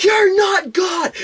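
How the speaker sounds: high, slow, drawn-out mock shout; imitated yelling. Imitating a woman ranting at bus passengers, high-pitched yelling. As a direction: screech it like a ranting stranger. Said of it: "she'd just break into yelling".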